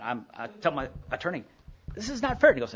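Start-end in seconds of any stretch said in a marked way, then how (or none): 1.9-2.5 high, loud protest; retold outburst. Complaining to his attorney about the divorce, loud and high. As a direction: cry out that it isn't fair.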